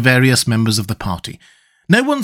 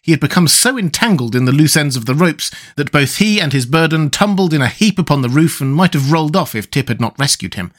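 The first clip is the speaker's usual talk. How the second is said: usual speaking voice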